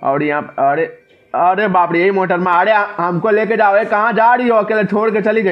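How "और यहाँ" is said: ordinary talk, nothing marked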